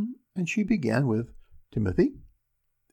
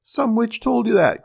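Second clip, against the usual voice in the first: the same rage pitched much higher, a shrill scream; furious shriek; 9 semitones higher, 6 dB louder than usual. A narrator's reading. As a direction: shriek it again even higher. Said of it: "cried the little man ... in a rage".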